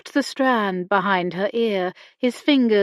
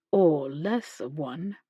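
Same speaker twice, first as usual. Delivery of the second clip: teasing; 5 semitones lower, 6 dB quieter, slower than usual. A narrator's reading. Teasing with lopsided grin.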